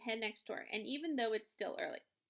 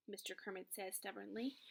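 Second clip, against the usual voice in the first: plain reading voice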